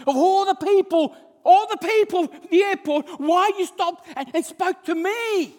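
high, loud, drawn out at the end; impassioned retold question. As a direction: cry it out as the amazed convert. Convert's astonished question, acted in sermon.

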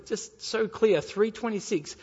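usual speaking voice

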